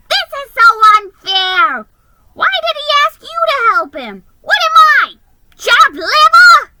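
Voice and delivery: high, loud furious rant; shrill jealous outburst; fuming. Acted. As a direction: rage at the unfairness. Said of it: "he fumed".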